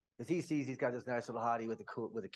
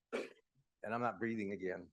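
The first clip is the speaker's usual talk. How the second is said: usual speaking voice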